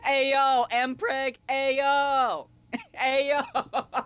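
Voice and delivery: playful mock outrage, high and loud; mock scandalized. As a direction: holler it like a cheeky heckle, breaking into laughter. Joking at her own innuendo.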